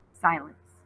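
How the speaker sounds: usual speaking voice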